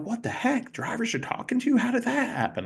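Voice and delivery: high-pitched incredulous imitation; mock disbelief. Bosses asking how drivers came to talk, mock disbelief. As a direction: squeal the questions like a baffled boss. Retold baffled bosses questioning him.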